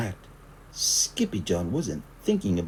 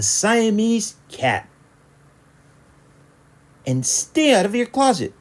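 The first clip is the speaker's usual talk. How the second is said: high mother voice laying down the law; stern mother; 13 semitones higher, 6 dB louder than usual. A narrator's reading. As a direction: lecture him like a stern mom. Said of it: "she added".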